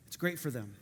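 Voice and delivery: usual speaking voice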